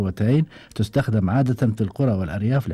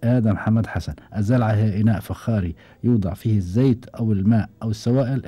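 ordinary delivery

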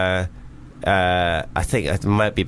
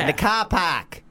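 put-on high, drawn-out Boston accent; 9 semitones higher than usual. Showing off to the host, Boston accent. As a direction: stretch it out in a raised Boston voice.

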